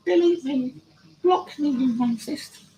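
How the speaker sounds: very high, drawn-out taunting voice; silly falsetto mimicry; mocking voice. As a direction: squeal it like a mock complainer. Mimics the blocked viewer's taunt.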